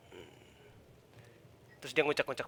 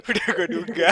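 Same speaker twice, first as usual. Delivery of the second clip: says it through real laughter; laughing; 6 semitones higher, 8 dB louder than usual. Real, not acted. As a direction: laugh while you say it. Laughing over an expected twist.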